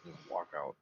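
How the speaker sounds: usual speaking voice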